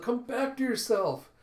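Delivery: high, loud, slow cry; desperate plea. A narrator's reading. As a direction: call out to someone lost in madness. Kippa pleads with maddened foxes.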